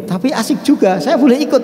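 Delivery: lightly voiced eager wife; wife's voice. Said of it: "Istrinya bilang".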